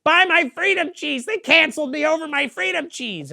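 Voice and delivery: high, loud whiny outrage; mock outrage. Playing the cancelled cheese seller, high and loud. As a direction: whine it like a self-made martyr.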